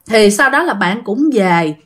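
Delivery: ordinary delivery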